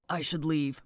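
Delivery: low and soft, lightly acted; mock brooding. Cal offering to leave the group, low mock brooding. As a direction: drop into a quiet, downcast quote.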